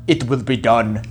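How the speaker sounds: low, soft, flat machine-like line; a lightly imitated dubbed-over Terminator; mock dubbed robot voice, low and quiet. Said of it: "they dub Arnold Schwarzenegger's voice".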